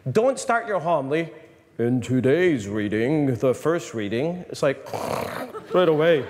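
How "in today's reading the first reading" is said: slow, low drone; mock boring preacher. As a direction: parody a dull homily opening. Mocking a boring homily opening.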